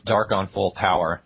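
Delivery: ordinary talk, nothing marked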